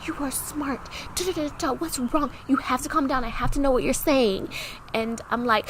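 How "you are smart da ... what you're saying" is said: higher, softer coaxing voice; tender motherly reassurance, pitched up; imitated soothing stepmom. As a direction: talk someone gently down from crying. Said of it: "talking to her so soothing".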